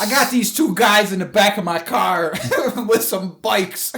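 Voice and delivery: high, loud quote broken by laughs; laughing. Retold or imitated. Driver retelling the bikes, laughing loudly. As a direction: laugh through the driver's words.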